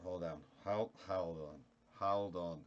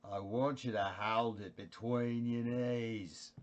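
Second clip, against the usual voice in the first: Australian Jack Nicholson; 4 dB louder, slower than usual. Pretend. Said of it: "Here's an Australian Jack Nicholson".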